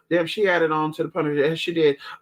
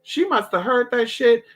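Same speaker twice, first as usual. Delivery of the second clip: ordinary talk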